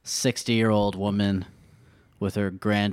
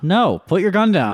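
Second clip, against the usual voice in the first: louder, higher, slow command; guard ordering; 8 semitones higher, 6 dB louder than usual. Acted. Security guard ordering the robber to disarm, loud. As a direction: make him drop the gun.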